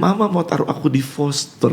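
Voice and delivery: a hint of a hurt small child; child's voice. Grandfather voicing the grandchild's fear of foster care, lightly childlike.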